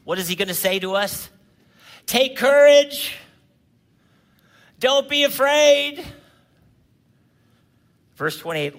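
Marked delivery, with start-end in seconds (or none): 2.0-6.0 slow, loud and higher, proclaiming each word; loud proclamation. Acted. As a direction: call out courage to the whole room. Preacher repeats Jesus's words to us.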